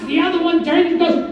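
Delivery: ordinary delivery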